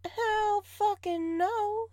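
drawn-out high indignant no; outraged no. Acted. To the cheeky partner, a slow high outraged refusal. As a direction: stretch it out high in mock outrage.

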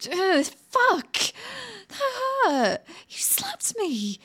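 high, sharp cry of pain. Acted. Woman slapped by her captor, high pained cry. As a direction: yelp as if just slapped.

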